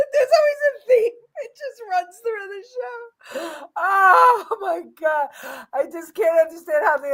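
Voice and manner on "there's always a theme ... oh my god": talking through real laughter, high-pitched; laugh-cry the words out, high; cracking up. Real, not acted. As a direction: still helpless with laughter. Still cracking up at audience.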